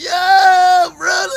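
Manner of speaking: screaming hype for a friend; excited hype shout. Pretend. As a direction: yell it like your buddy just scored. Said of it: "I'd be like".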